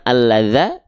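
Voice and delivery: the wrong vowel, "za", closing alladza. Shown as a demonstration. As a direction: end alladza on "za". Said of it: "Maka kita baca bukan". (alladza berakhir vokal A)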